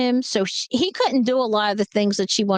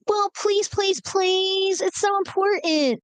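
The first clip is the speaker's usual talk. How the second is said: high-pitched, whiny mock begging; pleading; 9 semitones higher, slower than usual. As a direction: beg like a desperate little sister. Acting out sister begging.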